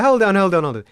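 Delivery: usual speaking voice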